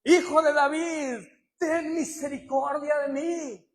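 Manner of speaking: loud pleading cry to Jesus. Retold or imitated. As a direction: call out to him desperately. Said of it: "él empieza a gritar". (grito suplicante)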